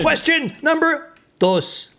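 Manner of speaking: high, slow game-show announcer voice; hammed-up host reading the card; a hammed-up quiz host announcing the next question; mock announcer.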